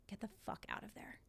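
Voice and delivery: very soft, nearly whispered order; retold hushed urging. Retold partner's urgent advice by phone.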